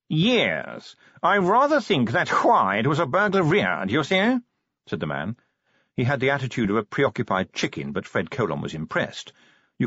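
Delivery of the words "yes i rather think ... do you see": languid upper-class voice, words half yawned; posh drawl. Acted. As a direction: speak like a bored aristocrat. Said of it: "modulated yawning".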